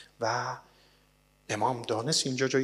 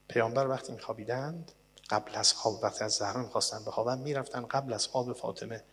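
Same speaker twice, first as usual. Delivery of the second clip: ordinary delivery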